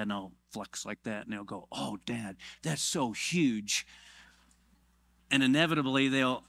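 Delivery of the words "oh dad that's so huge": slow, drawn-out admiration; imitated childlike awe, stretched out; mock awed child. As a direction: play a kid marvelling at Dad. Children admiring dad's muscles, acted.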